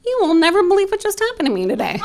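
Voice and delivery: high, fast and giddy; bursting with his big news, laughing; a thrilled husband gushing at his wife; excited husband. Retold or imitated. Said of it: "And he's like".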